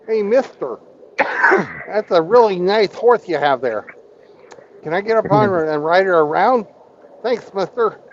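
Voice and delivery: high, lisping little-man voice with a lisp on "mister"; lisping midget voice. Retold or imitated. Telling the midget joke to Hammy, high lisping midget voice. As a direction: pitch up and lisp like the hair-lip midget. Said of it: "He doesn't talk too well".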